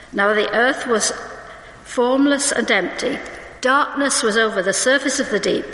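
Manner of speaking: usual speaking voice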